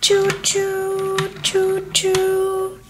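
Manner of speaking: high, drawn-out choo-choo; train whistle. Pretend. Conductor playing choo-choo train for the viewers, whistle imitation. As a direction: imitate a train whistle on one held note. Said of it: "the conductor of this choo-choo train".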